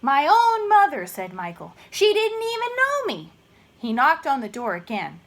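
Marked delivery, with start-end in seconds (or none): 0.0-3.3 dismayed wail. Acted. Michael dismayed.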